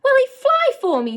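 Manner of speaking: high, loud eager question; can barely contain herself; excited. A narrator's reading. Girl asking the boy about the beetle, excited. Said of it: "Novak asked, excited".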